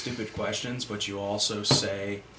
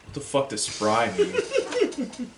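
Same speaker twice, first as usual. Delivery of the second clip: high, slow, drawn-out confusion; baffled disbelief; 8 semitones higher, much slower than usual. Retold or imitated. Friends baffled by a word in Bad Santa, high and slow. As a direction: stretch it out like you can't believe the word.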